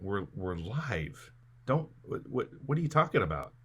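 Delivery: ordinary talk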